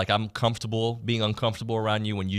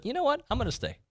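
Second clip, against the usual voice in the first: mock player quote; 3 dB quieter, faster than usual. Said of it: "the three guys ... that said".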